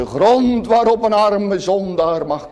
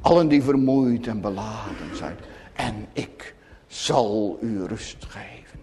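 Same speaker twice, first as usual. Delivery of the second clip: much softer, sinking into a whisper at the end; hushed invitation; 9 semitones lower, 7 dB quieter than usual. Retold or imitated. Preacher quoting the Lord's call to weary sinners, hushed and softening. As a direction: speak the Lord's call gently, almost breathed. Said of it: "omdat hij je roept".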